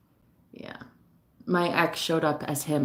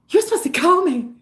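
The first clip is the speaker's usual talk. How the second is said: high-pitched, put-on movie whine; Mean Girls impression; 11 semitones higher, 4 dB louder than usual. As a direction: parody a wronged teen-movie girl. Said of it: "that movie Mean Girls".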